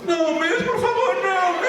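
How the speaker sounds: high, pleading squeal to the teacher; begging kid. Retold or imitated. Kid begging teacher not to call dad, high squeal. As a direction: beg in a high panicked squeak. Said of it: "I was like".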